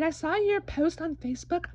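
ordinary talk, nothing marked

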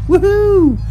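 normal talking voice

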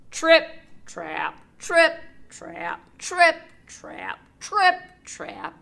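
loud, high stomping chant; heavy loud trip trap. A narrator's reading. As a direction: pound out each step. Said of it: "creaked and moaned".

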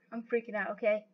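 soft, low admission; subdued aside. Real, not acted. Nervous player talking to themselves, subdued. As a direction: drop it right down, almost to yourself. Repeats it under the breath.

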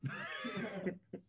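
a breathless, very high squeak of a laugh; a squeal of a laugh; airy high giggle. Real, not acted. Teasing the guest about the hug, with a squeaky giggle.

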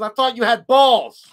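mock-challenging jab; teasing. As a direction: needle them playfully. Teasing the others.

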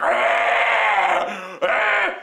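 grizzly bear roar. Retold or imitated. Comic imitating noisy stall neighbour, grizzly roar. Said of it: "It sounded like a grizzly bear was attacking".

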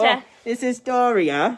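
high, loud mock-British introduction; attempted British accent. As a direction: announce the cat in over-the-top British. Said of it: "that accent you're doing".

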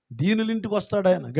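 ordinary talk

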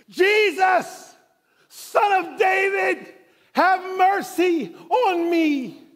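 high, loud, slow cry for mercy; desperate shout. Acted. Blind man crying out to Jesus over the crowd, loud and high. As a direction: shout it out, stretched and pleading. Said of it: "the Bible says he shouted".

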